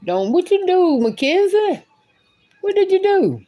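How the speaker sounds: high, probing what happened. Real, not acted. Parent asking the child what happened, high-pitched. Questioning a child who cried.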